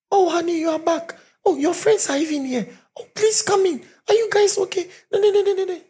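very high, squealing sweet wife voice; sugary wife. Retold or imitated. Preacher voicing the wife greeting her husband's friends in falsetto. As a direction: gush in a falsetto like a doting wife.

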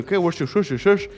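lightly mocked gringo English, slurred sounds; imitating gringos. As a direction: mimic gibberish American English. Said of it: "así es que suenan los gringos".

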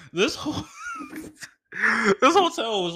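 high, loud words burst out through laughter; cracking up while telling the story; laughing loudly. Real, not acted. To his listeners, words bursting out through his own laughter.

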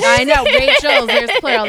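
high, loud and excited; excited outburst. Real, not acted. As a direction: blurt it out with glee. Both hosts are named Rachel.

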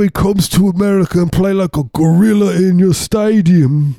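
high, loud cartoon Cockney drummer; mock Cockney. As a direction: ham up a broken Cockney accent. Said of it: "They're all Cockney, you know".

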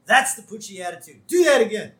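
high, loud delighted; excited. Retold or imitated. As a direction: cheer him on. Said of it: "And Myers says".

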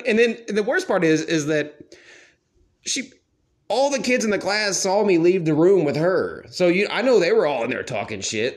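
ordinary talk, nothing marked

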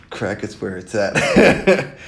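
slow and loud, laughing through the words. Real, not acted. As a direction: drag the punchline out while cracking up. Repeats punchline while cracking up.

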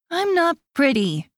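put-on a small boy objecting to being called pretty; little boy protesting. A narrator's reading. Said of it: "Michael protests".

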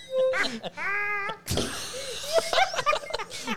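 high shrieking laughter. Real, not acted.

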